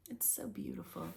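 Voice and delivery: a soft hushed aside; just breathe the words; whispered. Real, not acted. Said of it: "whispered aside".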